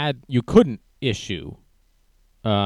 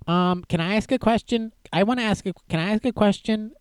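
much higher, a timid pleading put-on voice; 9 semitones higher than usual. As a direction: imitate the meek sidekick asking nervously. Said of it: "he says".

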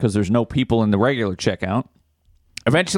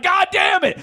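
angry shout. Retold or imitated. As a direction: blow up, swearing at the screen. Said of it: "I'm getting angry".

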